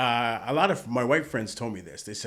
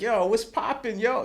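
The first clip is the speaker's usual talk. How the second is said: pitched way up, mock Toronto slang; street slang greeting; 10 semitones higher than usual. Friend imitating how he talks with his boys, high Toronto slang. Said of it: "I'm like".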